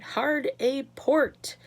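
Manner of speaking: slow, loud stretched-out command; yelled order. A narrator's reading. Mister Wonka yelling the steering order as the elevator swerves. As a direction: bellow the order to the crew. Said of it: "yelled Mister Wonka".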